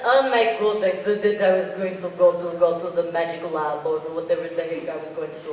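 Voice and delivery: lightly voiced flustered character. Shown as a demonstration. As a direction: babble in a fluster. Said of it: "different characters".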